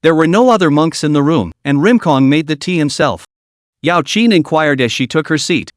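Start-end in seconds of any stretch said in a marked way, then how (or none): none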